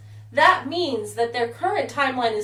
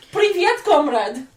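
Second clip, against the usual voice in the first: put-on Russian accent; 5 semitones higher, 4 dB louder, slower than usual. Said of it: "I don't know, Russian".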